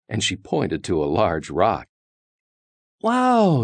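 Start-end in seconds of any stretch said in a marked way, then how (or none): 3.0-3.6 high-pitched storybook amazement; amazed wow. A narrator's reading. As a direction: marvel at it like wide-eyed kids. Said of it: "said the Super Kids".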